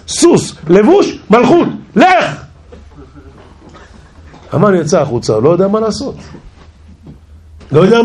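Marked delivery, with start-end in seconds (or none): 0.1-2.4 building royal excitement. Acted. King piling honours on Mordecai, building to a high cry. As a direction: heap on each honour, ending in a high cry.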